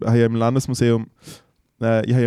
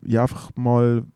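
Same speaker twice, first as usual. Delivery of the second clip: usual speaking voice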